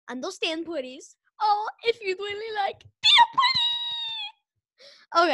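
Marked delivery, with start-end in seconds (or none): 1.4-5.3 high, loud cheer of the slogan; excited shout. Acted. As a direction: yell it out like a show catchphrase. Child shouting show slogan.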